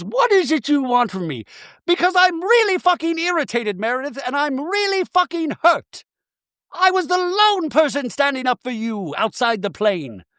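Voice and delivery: high, hammed-up grievance; irritated, hurt [name]. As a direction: imitate a wronged friend venting.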